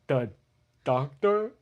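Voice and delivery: high, loud stumbling read; halting reader. Retold or imitated. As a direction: read it like you're struggling. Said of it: "I sound like I have a problem".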